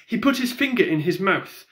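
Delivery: usual speaking voice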